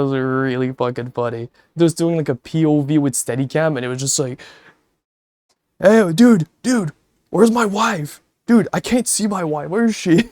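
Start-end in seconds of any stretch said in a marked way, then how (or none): 5.8-10.3 imitating Josh. Imitating Josh lagging at the wedding, high dopey calling. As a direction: play a confused guy calling for his wife.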